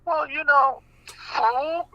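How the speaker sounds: ordinary delivery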